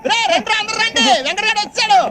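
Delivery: high-pitched rallying call; excited summons. Acted. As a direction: call the whole gang over. Summons everyone to come. (అందరినీ హుషారుగా పిలుస్తూ)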